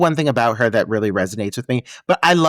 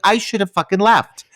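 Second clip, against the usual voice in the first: usual speaking voice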